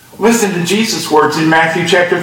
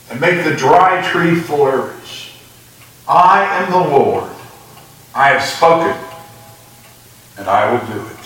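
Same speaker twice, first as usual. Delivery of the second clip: measured, lower solemn reading voice; scripture reading; 3 semitones lower than usual. Acted. Preacher reads Ezekiel to the congregation, solemn reading.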